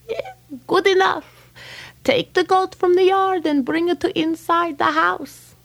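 high, quick instruction; brisk and pleased; eager rabbi. Acted. Rabbi telling the man to bring the goat, high.

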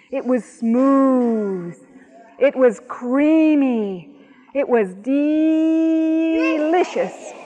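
slow, drawn-out relish; slow savoring. A narrator's reading. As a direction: savor each word slowly. Savoring the ice cream.

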